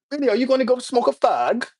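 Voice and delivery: high-pitched mock British accent. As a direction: put on a hammy, high British voice. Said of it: "that's my British accent".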